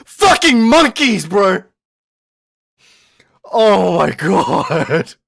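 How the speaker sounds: high, loud, slow outraged shout; ranting yell. Real, not acted. Streamer ranting at chat about classmates, furious yell.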